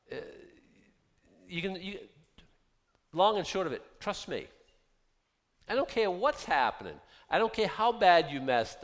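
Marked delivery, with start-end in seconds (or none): none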